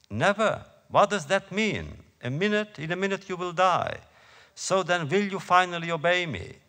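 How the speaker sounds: high, loud, incredulous demand; angry yelling. A narrator's reading. German officer demanding that the prisoner obey him, angry yelling. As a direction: raise pitch and volume to threaten. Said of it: "the German officer is yelling".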